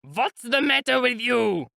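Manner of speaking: high, slow, drawn-out furious shriek. A narrator's reading. Mikolka screams at the failing mare in fury. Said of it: "Mikolka shrieked in a fury".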